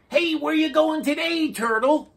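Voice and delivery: high, loud calling voice; lobster voice. A narrator's reading. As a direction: call out high and bright. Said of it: "asked the lobsters".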